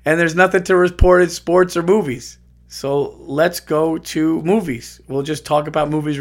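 mock host. Mocks empty-studio host.

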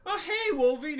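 high, bright mock greeting. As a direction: call out the name high and cheery. Deadpool greeting Wolverine.

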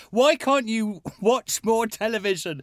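put-on parent pleading with kids. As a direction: plead with the kids, drawing the words out. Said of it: "I heard myself saying to my kids".

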